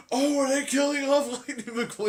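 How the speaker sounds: high-pitched mock panic, breaking into laughter; alarmed moviegoer. Shocked moviegoer reacting to the dark Cars trailer, high mock alarm. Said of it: "I remember people coming".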